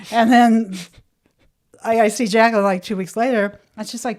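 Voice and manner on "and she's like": usual speaking voice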